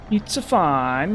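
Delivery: slow, sing-song mock Italian; mock Italian accent. Gamer consoling himself after a setback, mock Italian accent. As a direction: drag it out in cartoon Italian. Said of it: "I could do that accent".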